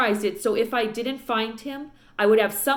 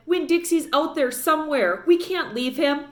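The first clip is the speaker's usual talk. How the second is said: high, louder protest; pleading protest; 7 semitones higher, 3 dB louder than usual. A narrator's reading. Girl protests to preacher father, high and pleading. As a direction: she refuses to stop searching. Opal begs to keep searching.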